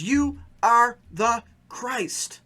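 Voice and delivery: very slow, weighty declaration; Peter's confession. Retold or imitated. Peter answering Jesus, slow and solemn. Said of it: "He says".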